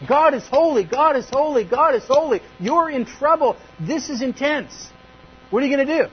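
loud, high, relentless pounding refrain; hammering. Acted. Preacher voicing the cherubim's message, hammering it out loudly. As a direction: hammer every phrase home at full volume. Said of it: "just hammering, hammering, hammering".

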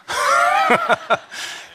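a real high-pitched laugh; high burst of laughter. Real, not acted. Pastor laughing about dreading a foot washing, high laugh. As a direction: let out a sudden laugh at yourself. Laughing at his own discomfort.

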